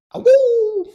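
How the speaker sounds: high wolf howl, fading; howling like a wolf pup. Retold or imitated. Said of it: "And the howling at the end".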